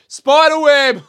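loud high call across the beach; laughing yell. Retold or imitated. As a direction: shout it out to the couple. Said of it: "I just yell out".

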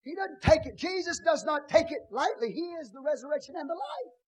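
shouted high, hammering each line; full-force preaching. Acted. Preacher shouting to the congregation, full force. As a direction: preach it out, lifting the whole room.

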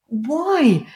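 slow, high-pitched exasperated wail. Real, not acted. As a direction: wail in disbelief, breaking into laughter. Ranting about the silly accents.

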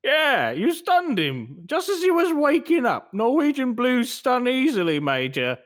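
high, swooping British shopkeeper; wheedling British shop owner; British accent. Acted. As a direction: explain it away in put-on British. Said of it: "We're gonna try our British accents".

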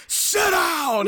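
high, loud barked command; angry shout. Retold or imitated. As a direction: shout it like a furious mother. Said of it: "she goes".

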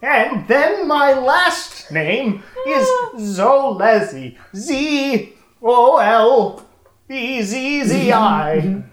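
put-on high, slow weird old man voice; drawn-out, creaky old geezer spelling; [name]'s old voice. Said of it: "I have to get back into my weird old person voice".

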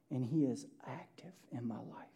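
soft, breathy whispered prayer. Acted. Praying to God in a hushed whisper.

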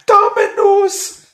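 slow high-pitched cry; high goofy shout. Pretend. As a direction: stretch it out way up high.

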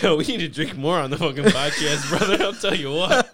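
loud laughing banter; laughing loudly. Real, not acted.

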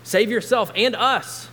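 loud, high railing taunt. A narrator's reading. Criminal on the cross to Jesus, railing. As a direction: rail at him, building to a shout. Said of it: "railed at him".

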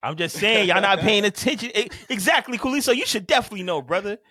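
high, loud, animated protest; heated insistence. Real, not acted. Podcaster telling co-hosts they miss the details, heated insistence.

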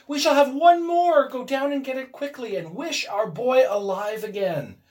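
high, loud, exultant urging; triumphant. A narrator's reading. Wife orders husband to wish son alive, triumphant. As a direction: start exultant and loud, easing into urgent pleading. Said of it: "she cried triumphantly".

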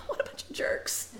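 breathy laugh through the words; whispered laughing jab. Real, not acted. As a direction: laugh it off in disbelief. Laughing at brothers.

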